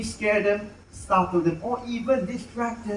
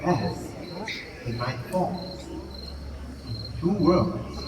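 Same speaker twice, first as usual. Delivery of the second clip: documentary show narration; 3 dB quieter, slower than usual. A narrator's reading. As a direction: draw the audience into the night, slowly. Show narration over music.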